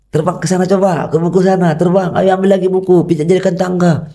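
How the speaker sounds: ajakan riang, nada jauh lebih tinggi; mengajak main. Retold or imitated. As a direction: membujuk anak dengan ceria. Ayah mengajak anak bermain. (ayah membujuk anak bermain, riang dan bernada tinggi)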